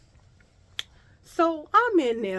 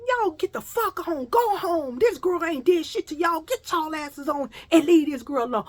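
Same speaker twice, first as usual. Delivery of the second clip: loud, high shout to leave; shouted warning; 7 semitones higher, 5 dB louder than usual. Retold or imitated. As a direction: drive the gang off from the door. Man chases off the girls.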